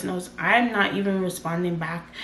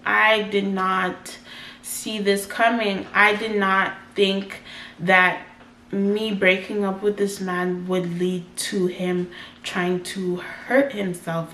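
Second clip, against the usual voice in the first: usual speaking voice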